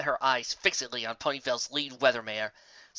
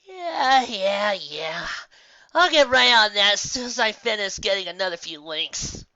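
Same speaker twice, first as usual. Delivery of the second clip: higher, louder lazy dismissal; yawning brush-off; 7 semitones higher, 6 dB louder, slower than usual. Acted. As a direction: wave it away mid-yawn. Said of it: "she yawned out a response".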